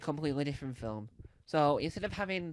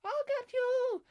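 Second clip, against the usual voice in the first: Elmo squeak; 9 semitones higher, much slower than usual. Retold or imitated. Elmo voice again in musical.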